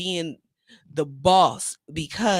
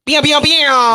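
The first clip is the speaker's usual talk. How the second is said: loud high "bam" siren; high, loud imitated alert sound; mock alarm blare; 7 semitones higher, 12 dB louder, slower than usual. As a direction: blare it out like an air horn.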